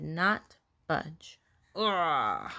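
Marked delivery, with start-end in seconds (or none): 1.8-2.5 long, low effort grunt; straining groan. A narrator's reading. Max tugging a huge pumpkin, low straining groan. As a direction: groan as if heaving a heavy weight.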